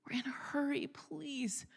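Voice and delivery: high, hushed pleading repeat; strained plea. Retold or imitated. Rushed mother urging her daughter along, hushed strained plea. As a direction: beg the child under your breath. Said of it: "let's just say added some passion to it".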